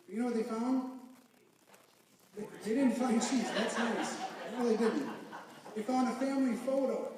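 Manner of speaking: emotional build-up. Real, not acted. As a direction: let the feeling swell as the story hits its point. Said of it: "I don't wanna get all emotional or cheesy like that".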